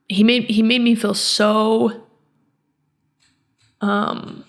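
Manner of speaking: choking up with real tears, halting. Real, not acted. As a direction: voice catching as the tears come. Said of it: "Sorry that I'm crying and being emotional".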